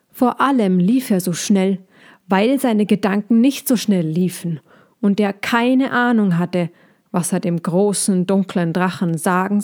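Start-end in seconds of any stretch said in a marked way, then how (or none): none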